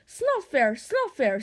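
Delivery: mildly huffy little dragon; snorted indignation. A narrator's reading. Furious Toothless protests to Hiccup, indignant snort. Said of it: "snorted Toothless".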